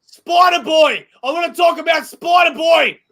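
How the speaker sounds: high, loud excited shout; yelling. Real, not acted. Host launching an exciting new topic, yelling. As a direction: belt it out like a big announcement. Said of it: "Why am I yelling?".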